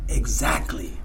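Exactly whispered, low and soft; whispered agreement. Retold or imitated. Sponsor confirming again, in a whisper. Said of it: "Eso que él me dice".

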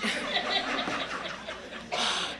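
a high, breathy gasp of shock, imitating the cousin; high mock gasp. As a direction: gasp like a prim cousin who is scandalized.

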